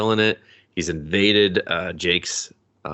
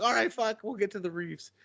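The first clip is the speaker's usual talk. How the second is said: high, quieter grumble; grumbled aside; 13 semitones higher, 9 dB quieter than usual. Retold or imitated. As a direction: mutter it under your breath. Annoyed kid brushing off.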